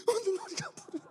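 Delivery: high, hammed-up tearful whine; comic sobbing worship; mock crying prayer. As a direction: blubber through a prayer in fake tears. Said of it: "막 울고 있어요".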